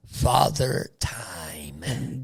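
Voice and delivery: slow, low ominous whisper. Acted. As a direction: breathe it slowly and darkly. Whispered dramatic repeat.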